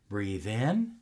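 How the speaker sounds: calm breathing guide trailing into breathy whispers; guided breathing. Shown as a demonstration. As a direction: guide the breath gently, fading down. Leads a breathing exercise.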